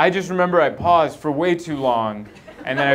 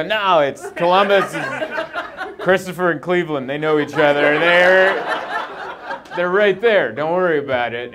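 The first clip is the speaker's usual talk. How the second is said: loud, higher mock reassurance; overconfident brush-off; 5 semitones higher, 4 dB louder than usual. Husband brushing off his worried wife, loudly overconfident. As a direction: wave the worry away, loudly and overconfidently. Breezily dismissing wife's worry.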